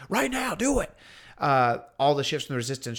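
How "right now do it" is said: high, strained mock yell; acted furious order, held back in volume; Kylo yelling. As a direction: imitate a villain yelling a command. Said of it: "as he yells".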